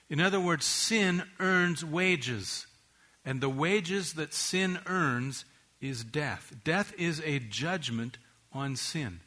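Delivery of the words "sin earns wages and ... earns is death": very slow, deliberate. Shown as a demonstration. Preacher spells out the verse.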